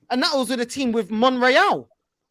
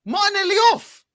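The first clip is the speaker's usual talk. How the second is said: disbelieving question; 10 semitones higher than usual. Real, not acted. Disbelief at sending off.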